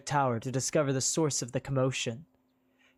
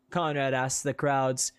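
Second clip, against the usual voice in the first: usual speaking voice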